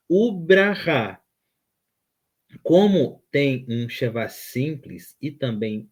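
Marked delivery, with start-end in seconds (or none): 0.1-1.2 "Ubraha" drawn out slowly, with a hard b; corrected b pronunciation. Shown as a demonstration. Teacher repeating the corrected word, slow "Ubraha" with b. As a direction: say "Ubraha" slowly and higher, b not v. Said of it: "é braha".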